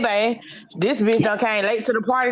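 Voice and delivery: normal talking voice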